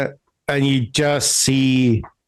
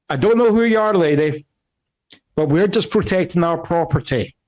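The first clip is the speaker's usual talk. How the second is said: higher-pitched man firmly justifying himself; raised-pitch defensive explanation; balcony guard; 5 semitones higher than usual. Acted. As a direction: argue your case from a balcony.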